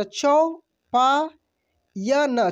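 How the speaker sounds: चौपायन drawn out very slowly, syllable by syllable; slow sounding out. Shown as a demonstration. Teacher sounding out चौपायन for students, very slow. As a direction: stretch out चौपायन for learners. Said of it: "च में औ की मात्रा".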